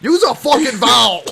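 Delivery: loud, high-pitched outburst; mock exasperated yell. Friend heckling fast Irish talk, loud mock yell. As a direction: holler it in comic exasperation.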